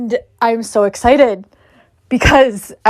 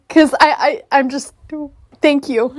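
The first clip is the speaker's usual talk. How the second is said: normal talking voice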